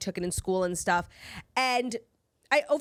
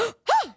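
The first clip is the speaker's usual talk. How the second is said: surprised gasp; 13 semitones higher, 5 dB louder than usual. Retold or imitated. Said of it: "I literally went".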